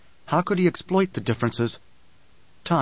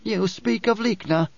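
higher character voice, measured and firm; Dory, firm woman; 7 semitones higher than usual. Acted. Dory urges Paul to take the dwarf, firmly. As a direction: lift the voice for a resolute woman.

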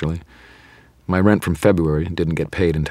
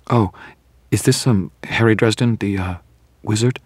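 hesitant, higher-pitched caller; apologetic; 5 semitones higher than usual. A narrator's reading. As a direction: ask timidly, afraid of giving offense. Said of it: "Her tone was apologetic".

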